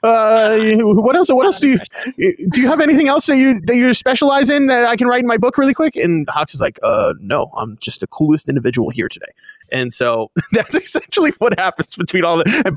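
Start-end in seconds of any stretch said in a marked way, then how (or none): 0.0-6.0 high, breathless fanboy babble; gushing Deku. Retold or imitated. Deku asks his hero for notes, gushing fanboy. 6.8-9.3 lower, offhand mock-cool voice; cool Hawks. Hawks answers a gushing fan, lower and too cool.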